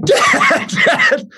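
very high imitated kid shriek; piercing, hammed-up panic call; mock terrified scream. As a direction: shriek for Dad like a scared boy. Imitates terrified son calling for Dad.

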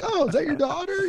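high-pitched mock-innocent chirp; feigned innocence. Storyteller playing dumb in the boss's office, feigned innocent small talk. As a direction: play dumb, pitched well up. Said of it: "like to pretend like I don't know why".

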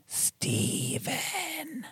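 soft, drawn-out ghost imitation; spooky, hushed EVP voice; ghostly voice. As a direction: stretch it out softly like a ghost.

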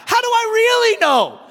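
mock heated challenge to the warden. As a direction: push back in disbelief. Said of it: "I got heated".

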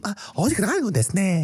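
high, fast girly mock-Japanese; squeaky anime girl voice; Japanese girl impression. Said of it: "Japanese girl impression".

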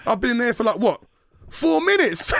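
high, loud, mocking taunt; cocky high crowing at the queue; gloating. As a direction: rub it in their faces.

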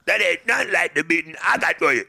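fast, loud, gruff mock Union Kane; quick, loud parody of a brutish boxer; imitating Union Kane. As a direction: rattle it off like a thug from the movie. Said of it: "sounding like a straight animal".

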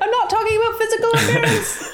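high fast quip through laughter; laughing retort. Acted. Hero snapping back at the monster, laughing. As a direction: fire back while laughing. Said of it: "And I just say".

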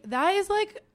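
high, loud self-defence, re-enacted; retold protest. Retelling her protest at being fired.

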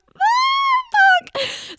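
high, drawn-out shocked squeal; shocked outburst. Retold or imitated. Reacting to surprise haunted house tickets, shocked squeal. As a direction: squeal it loud and long in mock horror.

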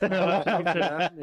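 yeah, yeah through a real laugh. Real, not acted. Co-host laughing off the question about him playing. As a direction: laugh it out as you answer.

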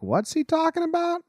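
very high, squeaky mock voice; mock bewilderment. Relatives on the other side of the door, mock bewildered. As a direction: imitate baffled eavesdroppers way up high.

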